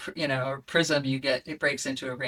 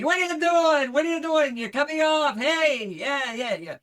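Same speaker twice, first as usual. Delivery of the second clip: high, loud shouty cabbie yelling; New York taxi driver; 12 semitones higher, 5 dB louder than usual. Shown as a demonstration. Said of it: "a taxi driver in New York City".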